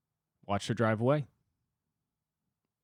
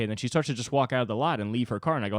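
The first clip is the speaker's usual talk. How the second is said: ordinary talk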